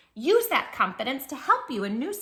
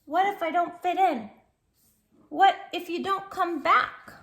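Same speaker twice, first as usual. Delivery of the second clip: put-on worried child; 8 semitones higher, 6 dB louder than usual. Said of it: "He says".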